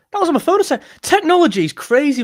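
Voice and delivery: high, loud gleeful amazement; amazed. Real, not acted. Streamer marvelling that airdrop worked instantly, high and loud.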